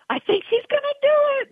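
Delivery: very high, fast excited squeal; excitement spilling over, racing and high-pitched; mounting excitement. Retold or imitated. Trainer reliving her filly nearing the win, wildly excited. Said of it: "you just get so excited".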